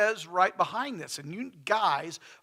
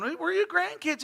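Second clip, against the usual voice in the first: put-on kids teasing back; 13 semitones higher, 4 dB louder than usual. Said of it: "I remember us going".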